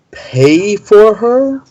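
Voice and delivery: slow, rising high-pitched question; incredulous question. Acted. Morin asks Valko about the pay, incredulous. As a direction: draw it out, climbing up in disbelief.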